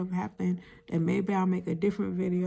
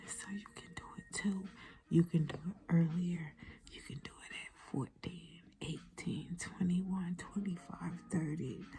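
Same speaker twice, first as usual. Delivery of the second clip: whisper; 9 dB quieter than usual. Real, not acted. Whispered encouragement to viewers.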